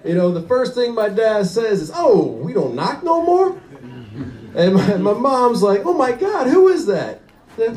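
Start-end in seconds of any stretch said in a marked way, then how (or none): 1.9-3.6 indignant caught dad. Retold or imitated. Dad caught cheating deflects. 5.8-7.2 higher, shocked mother; shocked mom. Retold or imitated. Mom discovers the affair.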